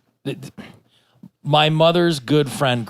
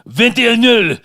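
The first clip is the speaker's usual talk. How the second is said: high, loud mock French; hammed-up Frenchman; French accent; 11 semitones higher, 8 dB louder than usual. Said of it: "And in French".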